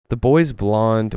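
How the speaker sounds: plain reading voice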